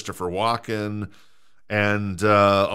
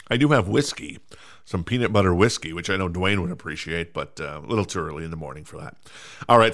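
ordinary talk

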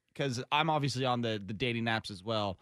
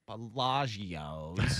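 drawn-out, questioning groan of the name; unimpressed; much slower than usual. Retold or imitated. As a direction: draw it right out, rising in doubt. Acting out reaction to parents' order.

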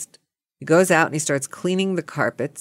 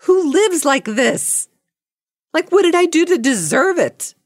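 high, dramatic wail of disbelief; high-pitched despairing complaint; exasperated outcry; 10 semitones higher, 3 dB louder than usual. Acted. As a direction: cry out at the unfairness, hammed up. Exasperated outcry about her day.

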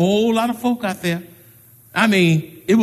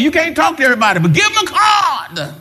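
preaching up; 9 semitones higher, faster than usual. Acted. Preaching climax.

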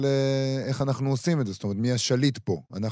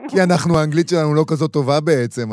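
excited; 5 semitones higher, 9 dB louder than usual. Real, not acted. Laughing excited remark after joke.

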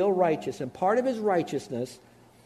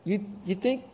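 much higher, mocking disbelief; incredulous Paul; 13 semitones higher than usual. Apostle Paul mocking foolish Christians in a high incredulous voice. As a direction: squeak it out like you can't believe them. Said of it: "he really speaks to Christians and he says".

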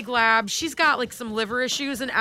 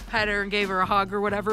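normal talking voice

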